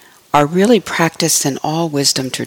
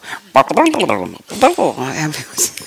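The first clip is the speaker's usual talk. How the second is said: imitated bubbling coffee percolator, pitch jumping up and down; playful mouth-made percolating sound; percolator noise. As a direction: burble like an old coffee pot in a commercial. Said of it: "like that Maxwell House commercial".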